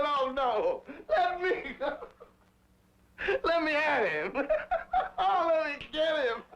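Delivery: high, laughing mock struggle; laughing while retelling. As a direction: act out being held back, cracking up. Held back by mother, laughing.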